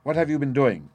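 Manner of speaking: loud, high challenge; called out. A narrator's reading. Narrator calls out to the woman creeping to the door, loud challenge. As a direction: call out sharply to halt someone. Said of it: "I called out".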